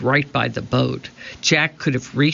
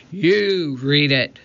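pirate captain. A narrator's reading. Pirate captain shoves map.

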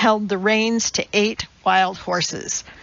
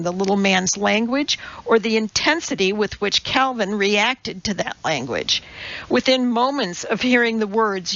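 plain reading voice

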